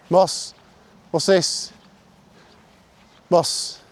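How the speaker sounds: high-pitched dog recall; calling the dog. Real, not acted. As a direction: call him back across the field.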